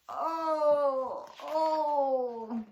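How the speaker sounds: loud, high sobbing groans; crocodile crying. Acted. Crying crocodile moans with his swollen jaw. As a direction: wail through real tears. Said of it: "he was crying real tears".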